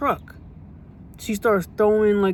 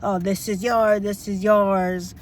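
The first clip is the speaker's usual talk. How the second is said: slow, dismissive boss impression; mocking the supervisor; slower than usual. Retelling how the supervisor binned her things, slow mocking tone. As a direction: toss it off like a careless boss. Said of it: "Starts throwing around my satchel".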